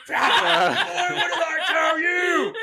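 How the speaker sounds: high, hollered Southern dad; Southern man. Acted. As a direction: drag it out in a hammed-up Southern yell. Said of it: "turned into a Southern man".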